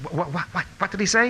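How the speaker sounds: stammered, high-pitched question; imitated startled disbelief. Rabbi challenged by his youngest student, startled stammering question. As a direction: stutter the word, then rise in astonishment. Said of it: "the Rav stopped in his tracks".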